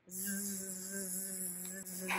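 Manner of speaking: soft breathy snore; snoring sound. Shown as a demonstration. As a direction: snore like a sleeping bear. Snore after refrain.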